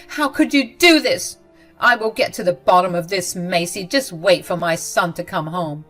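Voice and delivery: loud, indignant scolding; angry. Acted. Mother-in-law loudly scolding Macy over the cold. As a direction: threaten her, outraged.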